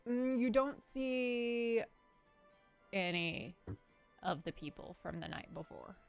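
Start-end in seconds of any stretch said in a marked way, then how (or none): none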